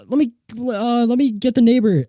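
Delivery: high, loud hurried offer; a rattled man going for help; flustered plumber. Retold or imitated. Plumber tells the kid he'll fetch help, high and flustered. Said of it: "And he's like".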